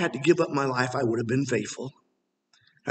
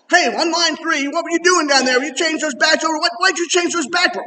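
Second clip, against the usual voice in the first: nagging boss; 9 semitones higher, 4 dB louder than usual. Retold or imitated. Factory boss phones at night.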